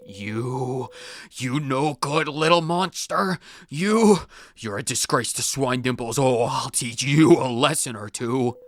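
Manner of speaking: a ghost teacher furious at a pupil; angry ghost. Acted.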